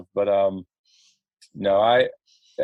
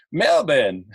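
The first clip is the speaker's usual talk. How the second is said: put-on Aussie-accented blurt, pitched up; hammy mock-Australian pronunciation; 4 semitones higher than usual. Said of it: "showing off my Australian accent".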